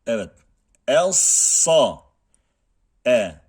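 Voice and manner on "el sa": "El sa" with thick, back-tongue heavy s; Arabic heavy sad. Shown as a demonstration. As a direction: say "El sa" loud with a full, heavy sad. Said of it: "İstiala ve itbak sıfatları".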